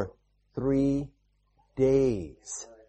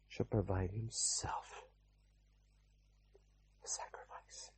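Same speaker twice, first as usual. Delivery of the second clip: whisper; 8 semitones lower, 20 dB quieter than usual. Acted. Abraham answers son about sacrifice.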